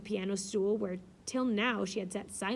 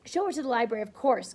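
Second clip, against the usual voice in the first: louder, imperious cut-in; cutting in; 7 dB louder than usual. A narrator's reading. Blanche cutting in over her mother, louder and commanding. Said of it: "cut in the angel girl".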